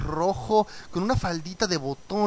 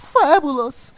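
fabulous agudo y fuerte, muy afectado; Rarity imitada; 8 semitones higher, 7 dB louder, much slower than usual. Retold or imitated. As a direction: suelta fabulous con aire de diva. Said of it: "adoro cómo habla Rarity". (fabulous dicho como diva, agudo y sonoro)

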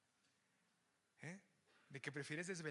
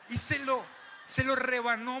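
loud, higher proclaiming shout; dramatic shout; 7 semitones higher, 13 dB louder than usual. Acted. Preacher shouts Samuel cut Agag to pieces. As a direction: belt it out to the crowd.

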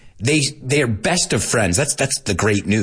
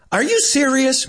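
much higher, incredulous question; high-pitched disbelief. Acted. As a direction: squeak it up high in mock disbelief. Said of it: "I'll say it in that high-pitched voice".

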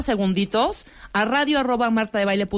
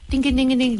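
chirped phone ring; imitated ringing phone. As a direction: ring like a telephone. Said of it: "phone ringing imitated".

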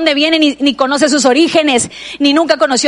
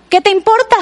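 higher, rising "¿Qué te importa?" flung back; mock snappy retort; 7 semitones higher, faster than usual. Neighbour snapping at the nosy woman again, higher mock sass. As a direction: toss the put-down back, hammed-up and sharp.

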